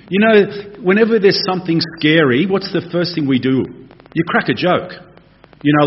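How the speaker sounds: ordinary talk, nothing marked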